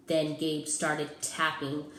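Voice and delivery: ordinary delivery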